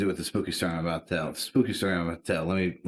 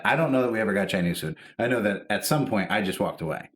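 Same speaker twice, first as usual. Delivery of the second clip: normal talking voice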